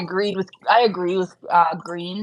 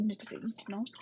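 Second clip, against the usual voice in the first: soft whispered coo; whisper to a pet; 18 dB quieter than usual. Real, not acted. Streamer whispers to her rabbit. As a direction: breathe it to the pet.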